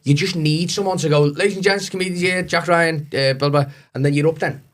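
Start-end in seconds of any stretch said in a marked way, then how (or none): 1.2-3.7 higher, hammed-up announcer voice; mock compere intro. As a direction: lightly ham up a pub MC's introduction. Imitating an MC introduction.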